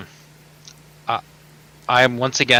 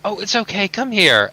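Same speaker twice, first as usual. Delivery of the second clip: put-on higher, tender soothing of a crying child; comforting; 8 semitones higher than usual. Adventurer comforting the sobbing girl, tender. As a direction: soothe her and beckon her close.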